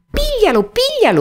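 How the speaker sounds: high, loud cry for help; yelling. A narrator's reading. Geppetto chasing runaway Pinocchio, yelling to passers-by. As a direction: shout it out urgently, pitch leaping way up. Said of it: "urlava Geppetto".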